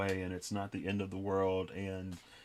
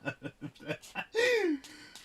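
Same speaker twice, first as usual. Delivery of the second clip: bursting into a high laugh; real high laugh. Real, not acted. Laughing at friend's offer to cry, real high laugh. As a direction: let a real laugh break out, pitched way up. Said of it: "I just laughed".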